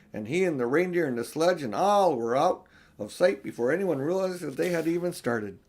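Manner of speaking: ordinary delivery, nothing marked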